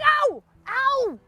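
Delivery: high, loud ow ow; wife yelping, hit on the leg; yelp of pain. Acted. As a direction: cry out sharply, way up high.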